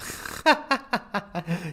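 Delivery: high-pitched burst of laughter. Real, not acted. Streamer amused by a player's one good game, high laugh. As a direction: giggle hard at your own remark.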